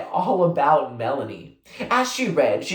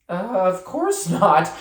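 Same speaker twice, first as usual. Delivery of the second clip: haughty; 5 semitones higher, slower than usual. A narrator's reading. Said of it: "April put back on her haughty face".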